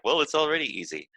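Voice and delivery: high, scoffing mock dismissal; mock dismissive people. Skeptics brushing off the easy-crypto idea, mock scoffing. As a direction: play the know-it-all who shrugs it off. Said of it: "that looked at us and like".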